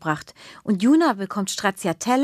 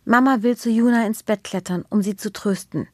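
plain reading voice

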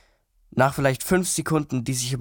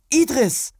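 higher, curt rebuke of his name; sharp; 12 semitones higher, 4 dB louder than usual. A narrator's reading. Ismira scolding Idris for his tactless remark, sharply. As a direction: snap his name to rein him in. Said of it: "sagte Ismira scharf".

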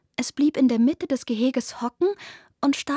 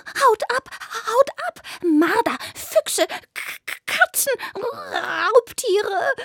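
shrill, much higher stammering rabbit voice; a terrified little rabbit chasing off predators; panicked rabbit; 12 semitones higher, slower than usual. Acted. Rabbit in panic yells at the intruding cats, stammering.

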